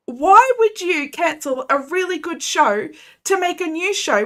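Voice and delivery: worked-up ranting; fuming rant. Real, not acted. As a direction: vent the fury at Netflix. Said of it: "Oh, I'm absolutely fuming".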